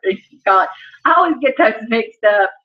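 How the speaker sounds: usual speaking voice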